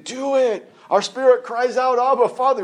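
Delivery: high, urgent preaching cry; spirit crying out. Acted. Preacher voicing the spirit crying to the Father, high and urgent. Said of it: "our spirit is saying".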